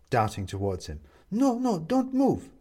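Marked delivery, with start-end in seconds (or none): 1.3-2.5 high, slow, concerned woman's voice; worried neighbour woman. A narrator's reading. Upstairs neighbour finding him on the floor, worried.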